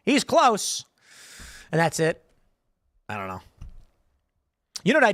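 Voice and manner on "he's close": imitating a gloating onlooker, pitched up; mock scoffing "Ha!", then a high exclamation; laughing deep-state guy. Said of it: "they're laughing, going".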